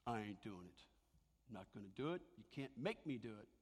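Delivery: mock stubborn Daniel; defiant refusal. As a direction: dig in and refuse flatly. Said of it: "Daniel could have said".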